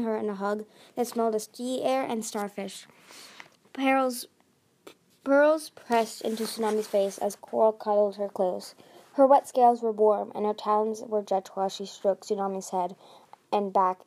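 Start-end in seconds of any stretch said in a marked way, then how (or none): none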